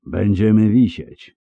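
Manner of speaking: ordinary delivery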